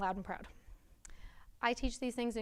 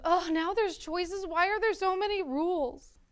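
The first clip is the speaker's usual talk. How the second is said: high, loud, drawn-out mock complaint; frustrated pupil; 9 semitones higher, 8 dB louder, slower than usual. Teacher imitating an overwhelmed sixth grader, high frustrated whine. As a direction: imitate an exasperated child wailing about the work, slowly. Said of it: "it was frustrating".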